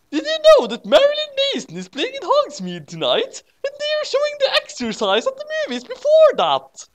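hammed-up shrill cartoon devil; squeaky Satan. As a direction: play Satan as a silly high-pitched goth. Satan voiced by reader.

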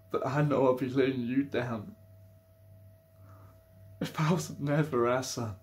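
distressed pleading. Acted. Partner tells a dying loved one they're not ready for goodbye, distressed. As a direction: plead through grief, holding on.

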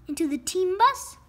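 ordinary delivery, nothing marked

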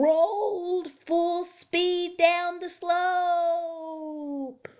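high, stretched-out downhill slide; drawn-out narration. A narrator's reading. Hedgehog rolls down the slope, long drawn-out high telling.

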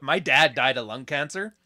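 usual speaking voice

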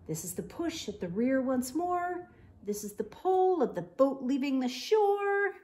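lively, animated bedtime story reading; picture book read-aloud with voices; reading to children. A narrator's reading. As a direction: read it bright and playful for little kids.

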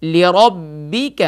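drawn-out melodic recitation; slow Quran recitation. Shown as a demonstration. Teacher leads the student line by line, slow chant. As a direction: stretch each word slowly for the student to repeat. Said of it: "دوبارہ پڑھیے".

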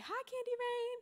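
high-pitched, affectionate hello imitation; Marcina's greeting. Marcina hugging a student who walks in, high sing-out greeting. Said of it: "give me a big old hug and say".

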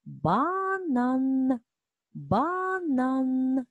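"банан" very slow and high, lingering on each н; stretched N sounds. Shown as a demonstration. Teacher gives the next word, "банан" with long н sounds. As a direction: draw out "банан" so every hard н rings. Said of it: "в слові банан твердий звук Н".

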